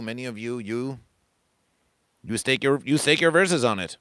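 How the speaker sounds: normal talking voice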